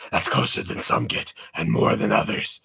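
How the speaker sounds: hushed, voiceless aside; low-key conspiratorial whisper; mock-secretive whisper. Mock-secretive aside on Batcave tour.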